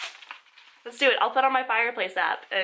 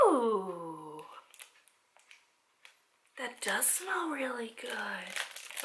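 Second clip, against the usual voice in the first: savouring; 2 semitones lower, 9 dB quieter, much slower than usual. Real, not acted. Smelling the tea.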